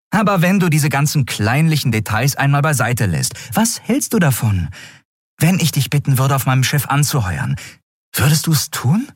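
high, coaxing character voice; high-pitched, hopeful recruiting pitch; persuasive Endriel. Acted.

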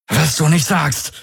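high, strained sarcasm; tense, gritted-teeth retort; through clenched teeth. A narrator's reading. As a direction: force it out through a locked jaw. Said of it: "presste Galen mit zusammengebissenen Kiefern hervor".

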